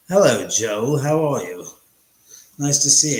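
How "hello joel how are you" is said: usual speaking voice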